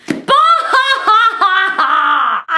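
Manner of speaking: big cackling laugh, high and loud; real loud laughing fit; loud burst of laughter. Real, not acted. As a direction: explode into laughter at the funny bit.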